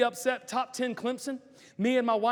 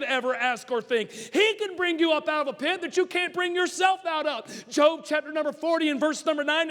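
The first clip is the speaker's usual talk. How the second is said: loud, higher preaching shout; preaching at full force; 7 semitones higher, 4 dB louder than usual. Acted. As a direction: preach it hard to the congregation.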